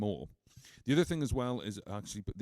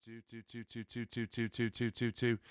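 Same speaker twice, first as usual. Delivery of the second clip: mic check count; 5 dB quieter than usual. Shown as a demonstration. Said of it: "using it in forty-eight volts".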